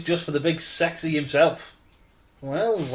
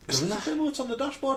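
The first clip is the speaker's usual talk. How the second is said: higher, quieter incredulous echo; mocking echo; 10 semitones higher, 6 dB quieter than usual. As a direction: parrot it back in disbelief.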